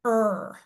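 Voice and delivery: er said as one r-coloured vowel; r-controlled er. Shown as a demonstration. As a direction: sound er like in her. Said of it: "The sound that it gives us is".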